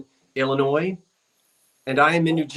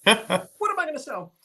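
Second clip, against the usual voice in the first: imitating her own last-minute fluster, much higher; a hammed-up fretful question; mock panic; 10 semitones higher than usual. Host mimics herself scrambling before the sale, mock panic. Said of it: "running around in the last ten minutes going".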